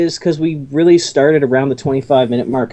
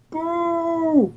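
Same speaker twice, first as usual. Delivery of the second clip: mock heckler boo; 11 semitones higher, 7 dB quieter than usual. Heckling the joke.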